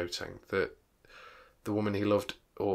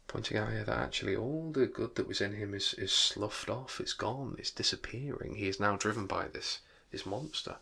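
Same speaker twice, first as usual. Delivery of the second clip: usual speaking voice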